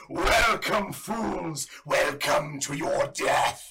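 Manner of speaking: booming slow villain voice; magically amplified voice. Acted. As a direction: boom it out like a trap's voice. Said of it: "you hear a booming voice go".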